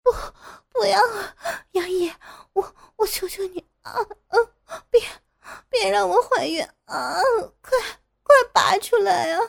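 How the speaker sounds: high, panting tearful begging; breathless pleading. Acted. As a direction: beg between gasps, high and crying. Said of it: "傅菊英娇喘着哀求". (娇喘着哭求)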